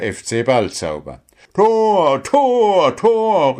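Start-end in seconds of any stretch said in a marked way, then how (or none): 1.5-3.6 loud, high, thrilled goal shouts; Paul cheering. A narrator's reading. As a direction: shout each goal cry with delight. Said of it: "rief Paul begeistert".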